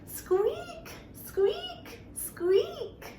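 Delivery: very high squeaky squeak; high mouse squeak. Shown as a demonstration. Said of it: "Let's make that mousy sound".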